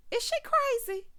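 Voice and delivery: very high disbelief; incredulous. Real, not acted. Asking viewers about the crying contestant, very high and incredulous. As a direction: shoot it up in disbelief.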